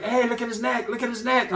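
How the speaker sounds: hammed-up busybody aunt; tattling aunts, imitated. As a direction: tattle insistently in a mock female voice. Acted aunts tattling about hickeys.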